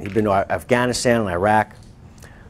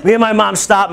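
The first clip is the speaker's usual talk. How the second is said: usual speaking voice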